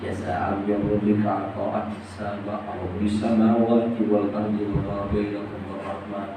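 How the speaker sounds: slow, melodic chanted recitation; Quran recitation. Acted. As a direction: draw each phrase out in reverent chant. Quran verse recited in sermon.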